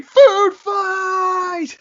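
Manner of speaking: high, drawn-out battle cry; hammed-up, very high yell; screaming. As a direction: scream it out loud like starting a riot. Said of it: "Vin's just running around screaming".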